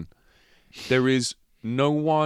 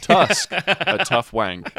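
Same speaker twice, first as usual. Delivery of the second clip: mock tagline; 7 semitones higher, 5 dB louder, much slower than usual.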